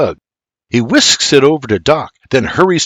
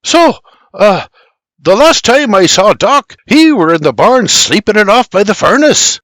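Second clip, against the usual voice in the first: higher, louder character voice; flustered character answer; 8 semitones higher, 5 dB louder than usual. A narrator's reading. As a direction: answer in an agitated raised voice.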